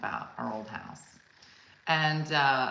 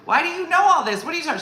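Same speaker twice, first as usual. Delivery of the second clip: much higher, louder confusion; exasperated; 12 semitones higher, 8 dB louder, much faster than usual. Retold or imitated. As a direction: demand answers, exasperated. Exasperated retort.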